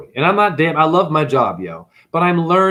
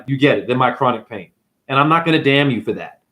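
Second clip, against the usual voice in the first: ordinary talk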